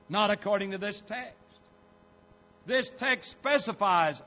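loud key phrases with deliberate pauses; emphatic pulpit argument; forceful preaching. Real, not acted. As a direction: drive the question home to the congregation. Preaches his conclusion.

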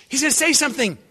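high, loud announcement; excited alert. Acted. Bystander to those gathered near Jesus, excitedly alerting. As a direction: pitch it up to alert the room.

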